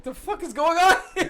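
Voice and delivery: laughing, high shocked outburst; shocked exclamation. Retold or imitated. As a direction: blurt it out in disbelief, laughing. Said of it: "I was like".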